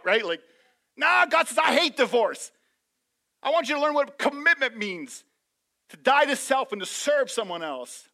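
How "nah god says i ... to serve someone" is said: raised high, loud proclamation of God's word; loud preaching. Acted. Preaching God's words at full force.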